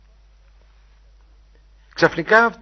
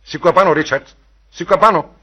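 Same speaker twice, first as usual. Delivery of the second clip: loud, high, urgent shouting; raised voice, pressing and insistent; shouted plea; 7 semitones higher, 9 dB louder than usual. A narrator's reading. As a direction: urge a fallen friend to get up. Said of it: "φώναξε".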